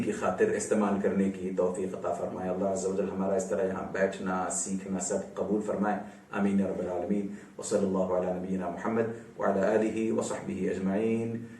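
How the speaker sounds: a quiet, devout closing supplication; soft closing prayer. Acted. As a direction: close the lesson softly in prayer.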